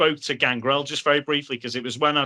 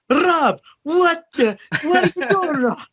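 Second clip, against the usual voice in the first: very high, loud panicked yell breaking into a laugh; losing his mind; 4 dB louder, slower than usual. Retold or imitated. Pat Patterson yelling at Rob as he crowd surfs away, high panicked shriek. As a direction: shriek it like a manager watching chaos. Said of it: "Pat Patterson is losing his mind".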